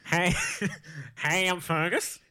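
slow, put-on Kentucky drawl; shaky-confidence stab at Kentucky, slowly; Kentucky accent. Shown as a demonstration. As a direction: attempt a Kentucky accent, drawing it out. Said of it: "this time do a Kentucky accent".

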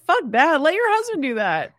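ordinary delivery

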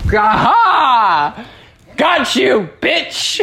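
high-pitched gotcha shout; triumphant cry. Pretend. Podcaster catches the attacking bear, mock-triumphant shout. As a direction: pounce in playful triumph.

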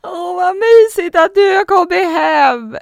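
high, loud, drawn-out wail; hammed-up tearful shriek of joy; mock crying scream. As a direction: scream it like an over-the-top sobbing welcome. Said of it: "Ligger du och skriker typ så här".

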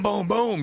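high, punchy booms; imitated gunshots. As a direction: fire off mock gun blasts.

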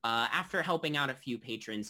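ordinary talk

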